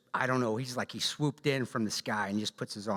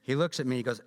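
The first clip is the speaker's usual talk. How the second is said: normal talking voice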